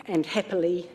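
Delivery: usual speaking voice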